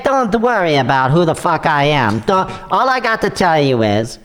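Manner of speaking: a hint of an Italian accent, shady caller. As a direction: fake Italian accent, scammer on the phone. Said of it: "sounds like an Italian living in Nigeria".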